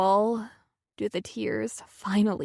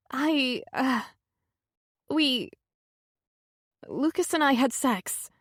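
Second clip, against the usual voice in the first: hesitant confession; 5 semitones higher than usual. A narrator's reading.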